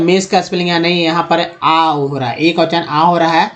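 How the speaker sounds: ordinary talk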